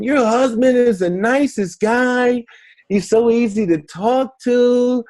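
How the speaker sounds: high, drawn-out mock gushing; gushing admiration. Guests praising a husband to his wife, gushing. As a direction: imitate fawning fans, slow and high-pitched.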